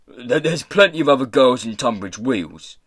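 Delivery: pirate boss, bewildered. Acted. Pirate boss dissuading the gentleman, blustering. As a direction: bluster through the objection.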